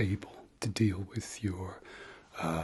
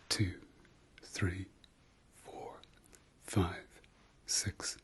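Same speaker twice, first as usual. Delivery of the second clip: guided breathing meditation. Shown as a demonstration. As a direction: guide them through each breath, leaving room between numbers. Leads breathing exercise.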